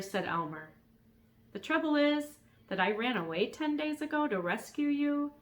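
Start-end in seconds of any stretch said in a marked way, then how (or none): 1.5-5.3 put-on young boy voice. Elmer voiced in story.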